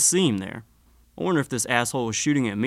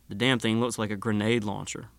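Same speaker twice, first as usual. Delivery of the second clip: usual speaking voice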